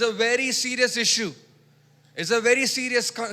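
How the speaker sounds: fervent sermon delivery; preaching. Acted. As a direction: drive it home to the congregation. Preaching at full force.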